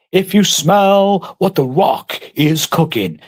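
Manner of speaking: slow, drawn-out wrestler catchphrase voice; milk it like a wrestling promo; The Rock catchphrase. Retold or imitated. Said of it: "The Rock has got a good song".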